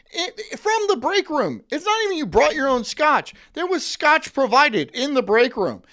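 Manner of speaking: rising indignant excitement; excited rant. Real, not acted. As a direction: get fired up about the free scotch. Ranting about Mad Men offices.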